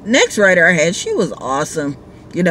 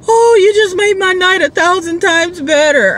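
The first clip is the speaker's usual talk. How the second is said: put-on overjoyed drunk rider; 12 semitones higher, 4 dB louder than usual.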